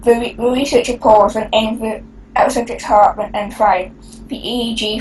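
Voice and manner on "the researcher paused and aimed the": usual speaking voice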